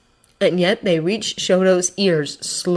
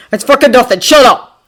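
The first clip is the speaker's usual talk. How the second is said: loud, higher irritable retort; angry growl; 6 semitones higher, 10 dB louder than usual. A narrator's reading. Bakugo dismisses his wound, growling angrily. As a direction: growl it to brush off concern. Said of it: "he growls".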